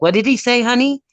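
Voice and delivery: a hint of a confused grandma asking her grandson; hard-of-hearing grandma. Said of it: "my grandma's like".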